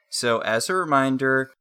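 ordinary talk, nothing marked

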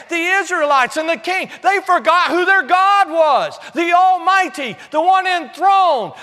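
high, loud, driving sermon delivery; ringing, emphatic proclamation; full-force preaching. Real, not acted. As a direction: build it like a fired-up preacher.

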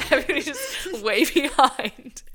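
laughing through the words; giggling while talking. Real, not acted. Laughing at the bit.

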